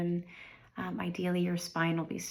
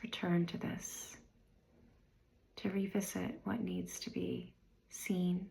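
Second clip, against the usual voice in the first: guided meditation. Real, not acted. Meditation guide inviting listeners to review the year, unhurried and calm. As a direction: guide them gently inward, leaving space after each phrase.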